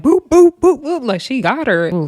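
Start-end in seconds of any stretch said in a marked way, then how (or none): 0.0-1.0 high, loud boop boop for quick punches; punch sounds. Retold or imitated. Describing the fight, she voices Summer's rapid punches. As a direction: pop each boop like a jab landing. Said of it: "Summer was like".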